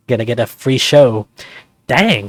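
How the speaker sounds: ordinary talk, nothing marked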